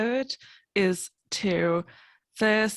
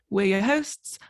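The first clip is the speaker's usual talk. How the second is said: normal talking voice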